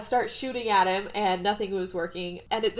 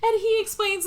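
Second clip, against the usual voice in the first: very high, loud laughing squeal; real cracking up; 14 semitones higher, 4 dB louder than usual. Real, not acted. Telling the fake accent reveal, real cracking up. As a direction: squeal it through helpless laughter.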